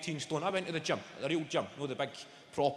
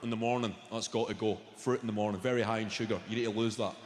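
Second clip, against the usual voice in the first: lower, faster mock gym trainer; gym guy voice; 8 semitones lower than usual. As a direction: lecture him like a meathead trainer. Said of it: "The guy's going".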